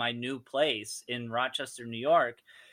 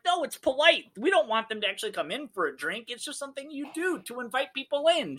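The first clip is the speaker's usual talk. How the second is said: high, fast hammed-up mom voice; playing up the mom; 10 semitones higher, faster than usual. [name] playing Rafa's mom to the dad, hammed-up high voice. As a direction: ham up the polite mother. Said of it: "she's playing up the part big time".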